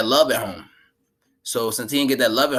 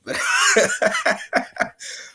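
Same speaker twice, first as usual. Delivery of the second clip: real high wheezing laugh. Real, not acted. Laughing at own mock wail.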